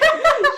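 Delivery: high loud laugh; burst of laughter. Real, not acted. Telling friend the story, bursting into laughter.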